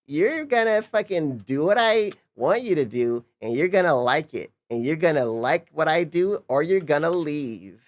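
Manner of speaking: hammed-up imitation of a bossy streamer; mocking imitation. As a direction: ham up a spoiled entitled boss. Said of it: "And they're like".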